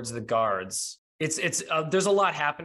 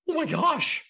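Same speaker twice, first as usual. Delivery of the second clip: much higher, stunned exclamation; shocked gasp; 14 semitones higher, 4 dB louder than usual. Acted. Game master as Adam, shocked to find Cerulean, high. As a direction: caught off guard, breathless. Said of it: "Adam goes".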